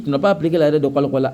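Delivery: ordinary delivery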